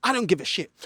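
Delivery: ordinary talk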